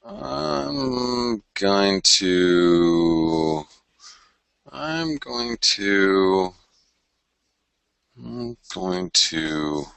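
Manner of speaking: absent-minded, long-held filler phrase; drawn-out stalling. Real, not acted. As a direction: drag each word out while busy searching. Stalls while working the screen.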